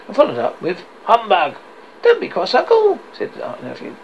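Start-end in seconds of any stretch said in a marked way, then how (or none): 1.0-1.5 loud, higher dismissive burst; grumpy scoff. Acted. Scrooge follows up with humbug, loud scoff. 2.0-3.0 very high, loud coaxing; cheery nephew's plea. Acted. Nephew tells his uncle not to be cross, high coaxing plea.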